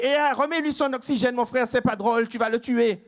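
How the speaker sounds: cri aigu affolé; panique. Acted. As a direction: supplier qu'on arrête la blague, paniqué. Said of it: "le Guyanais en panique". (Guyanais paniqué qui crie plus aigu)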